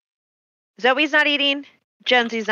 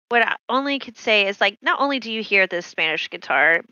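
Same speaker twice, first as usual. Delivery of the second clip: usual speaking voice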